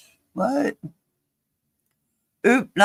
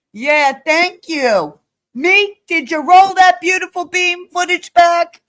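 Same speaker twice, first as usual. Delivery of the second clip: ordinary delivery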